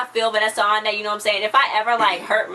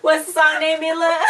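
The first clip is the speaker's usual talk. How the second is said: louder and higher, laughing through the words; laughing question; 8 semitones higher, 5 dB louder than usual. Real, not acted. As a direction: ask your friend while still laughing. Laughing while asking friend.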